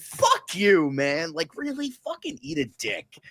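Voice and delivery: high, exasperated outburst; incredulous fury, pitch jumping high; exasperated rant. Real, not acted. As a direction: vent disgust at the trailer. Furious rant at trailer.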